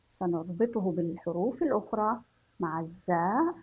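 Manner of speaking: normal talking voice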